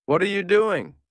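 a cry of alarm at the gun; loud voice. A narrator's reading. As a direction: shout it high and loud. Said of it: "his voice still loud".

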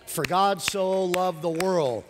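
solemn scripture. Acted. Quoting scripture slowly.

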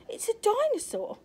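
high, loud shocked cry; a mother's fright at a dinosaur; horrified mother. Acted. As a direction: gasp it out in horror.

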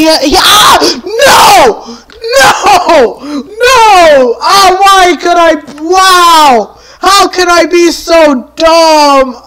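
high, loud wailing shouts of despair; anguished yelling. Real, not acted. Player dying by own mistake, anguished yelling. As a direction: scream in frustration at your own blunder.

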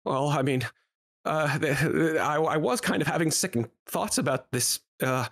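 stammering, rising to a high plea; pleading halfling. Acted. Halfling explaining his family needs food, pleading and faltering.

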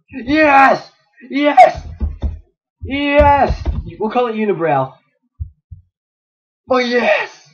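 high, loud shouts of victory; triumphant shouts. Real, not acted. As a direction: cheer it out. Taming the unicorn.

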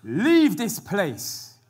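very slow, weighty command; grave warning. Retold or imitated. Angels warning Lot's family, slow solemn command. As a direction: draw out each word like a warning. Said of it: "The angels gave the warning again".

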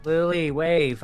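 put-on loud, slow call to a child. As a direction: call over to her, drawing the words out. Calling child to face camera.

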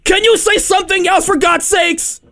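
high, exasperated shouting; mock anger. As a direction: yell as if at the end of patience. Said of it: "getting angry".

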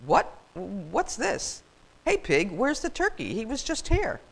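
lightly voiced puzzled farmer; a hint of a baffled farmer; confused farmer. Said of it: "spoke in a confused voice".

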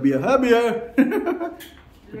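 slow, high, giggling repeat. Real, not acted. Laughing at toddler's word.

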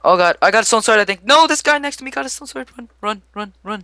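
panicked high cries; yelling while running away. Real, not acted. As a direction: yell it while fleeing in panic. Fleeing a sword-wielding player.

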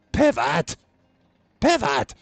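high, strained loud yelp; bad David Schwimmer. Streamer maneuvering in a game, shrieked bad sitcom impersonation. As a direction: repeat it as a frantic sitcom shout. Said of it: "David Schwimmer impersonation".

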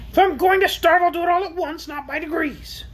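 shrill, high, stubborn creature voice; demanding. A narrator's reading. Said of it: "demanded the orc".

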